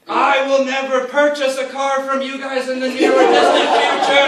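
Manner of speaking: high, very loud yell over the gunfire; hammed-up furious yell; comic yell. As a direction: shout it across the deck. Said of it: "and he yelled".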